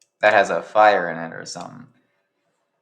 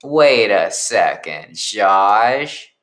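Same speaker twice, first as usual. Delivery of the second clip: slow realization; much slower than usual. Acted. Rat Girl voiced, slowly.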